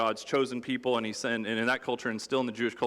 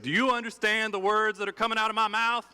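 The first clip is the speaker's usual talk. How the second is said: Chris Tucker; 9 semitones higher than usual. Retold or imitated. Comic cop yelling at his partner, high and exasperated. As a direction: play the exasperated buddy-cop sidekick. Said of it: "like, Chris Tucker, uh, to Jackie Chan".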